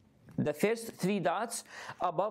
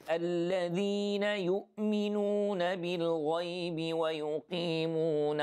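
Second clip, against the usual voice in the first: Quran recitation. Shown as a demonstration. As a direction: recite the verse with measured tajweed. Recites ayah three.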